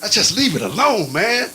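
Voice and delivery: normal talking voice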